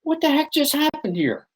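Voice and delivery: puzzled, replaying it in disbelief; baffled thought. Hiker left alone in the fog, amazed by the stranger. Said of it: "And I thought".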